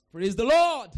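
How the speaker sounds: very loud, high praise call; booming church acclamation; rallying shout. Real, not acted. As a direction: shout it out to the whole congregation. Rallying the congregation.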